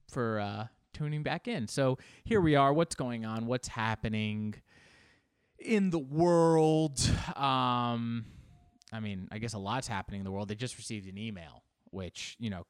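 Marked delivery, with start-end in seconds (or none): 5.6-7.0 slow, louder, higher drawn-out question; hammy drawn-out flourish; mock-dramatic. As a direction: stretch WORLD out big and high. Mock-dramatic rhetorical opening question.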